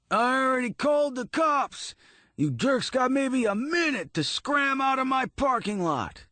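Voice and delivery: high furious shout at punks; screaming. A narrator's reading. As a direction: bellow them off the lot. Florian yelling with knife.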